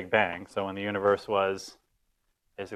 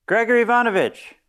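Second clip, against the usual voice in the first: high, loud call down to the neighbour; calling out the window; 12 semitones higher, 4 dB louder than usual. Retold or imitated. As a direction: yell the name out of the window. Wife calls out window downstairs.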